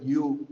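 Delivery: U said the English way, as "you"; English letter name. Shown as a demonstration. Teacher showing gringo sounds, U pronounced "you". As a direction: glide from y into oo on you. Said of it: "el vocabulario gringo".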